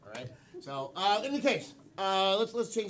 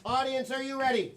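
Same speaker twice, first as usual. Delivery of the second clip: loud, high crowd-rousing call; rally the whole audience; rousing host shout; hyping up; 6 semitones higher, 6 dB louder than usual. Real, not acted.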